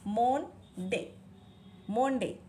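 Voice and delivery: मोन डे, split into two syllables as spelled; phonetic misreading. Retold or imitated. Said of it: "तो इसी तरह से प्रोनाउंस किया".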